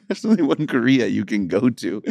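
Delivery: normal talking voice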